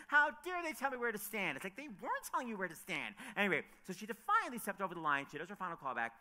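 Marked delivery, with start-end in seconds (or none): 0.1-1.5 indignant. Retold or imitated. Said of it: "She's like".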